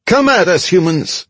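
very high, squeaky little creature; squeaky goblin voice. A narrator's reading. Goblin taunts two charging soldiers, high squeaky voice. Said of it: "a squeaky voice said".

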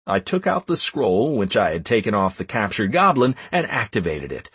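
ordinary delivery

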